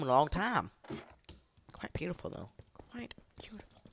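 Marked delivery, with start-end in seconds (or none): 2.9-3.8 very soft, hushed murmur; breath-only aside; admiring whisper. Real, not acted. Whispered repeat admiring home.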